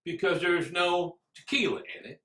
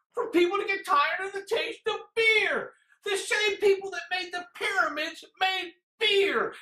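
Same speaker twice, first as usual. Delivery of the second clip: put-on cranky old-man rant; 11 semitones higher than usual. Old-timer ranting against flavored beer, cranky and exasperated. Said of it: "like the cranky old man that yells".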